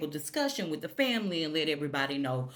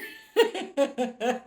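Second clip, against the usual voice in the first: high laughing burst; a real laugh at the impression; burst of laughter; 7 semitones higher, 4 dB louder than usual. Real, not acted. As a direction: crack up at the joke. Laughs at own bit.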